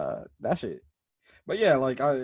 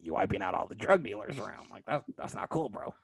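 crackhead voice; 4 semitones lower, 7 dB quieter than usual. Acted. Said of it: "the crackhead voice".

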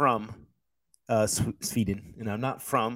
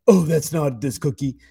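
slow, louder mock complaint; mocking commenter; 7 dB louder than usual. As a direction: drag it out like a whining objector. Mimicking people who argue online.

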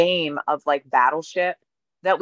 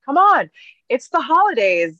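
higher, louder "it's the holidays"; upbeat coaxing; 8 semitones higher, 5 dB louder than usual. Retold or imitated. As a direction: cheer them on into the season. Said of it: "I just love to repeat".

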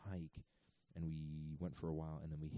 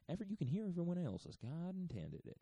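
Southern religious voice; 13 semitones higher than usual. Acted. Joking about church acoustics, high Southern voice. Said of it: "I do a Southern voice for religion".

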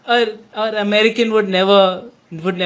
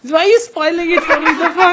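laughing protest; 9 semitones higher than usual. Real, not acted. Friend spoils the manga, laughing.

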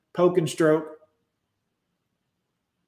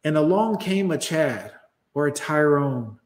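ordinary delivery

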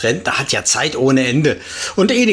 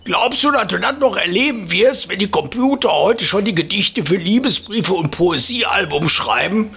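put-on teasing old man, higher; old neighbour voice; 8 semitones higher than usual. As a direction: needle the younger man. Opa teasing narrator again.

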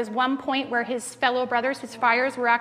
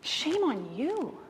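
gentle rebuke; 3 semitones higher, much slower than usual. Retold or imitated. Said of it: "And Francis says".

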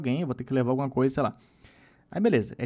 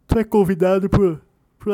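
words stretched by a yawn; 5 semitones higher, 7 dB louder than usual. Real, not acted. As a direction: say it mid-yawn. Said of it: "eu já tô bocejando já".

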